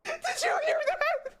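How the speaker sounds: high, breathless shrieks of laughter, close to tears; hysterical laughing. Real, not acted. Gamer startled by a scary sound, laughing hysterically. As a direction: laugh in shock until it almost turns to crying.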